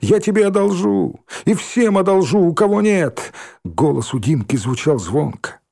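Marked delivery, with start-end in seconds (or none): 0.0-3.3 higher, ringing happy call; joyful shout. A narrator's reading. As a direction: shout the offer gladly. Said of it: "радостно закричал". (радостный возглас)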